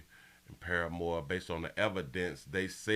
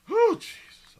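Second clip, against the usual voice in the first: high breathless exhale; winded whew; 10 dB louder, slower than usual. Real, not acted. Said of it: "I'm almost out of breath".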